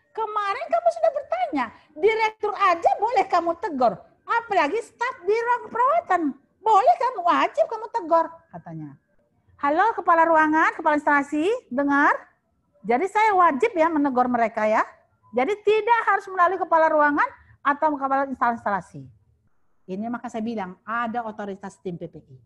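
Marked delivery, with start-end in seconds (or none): 0.1-8.3 imitating a boss's high, loud go-ahead; emphatic director. Acting out director's emphatic public answer. 9.6-19.1 loud re-enacted address to the unit heads; emphatic announcement.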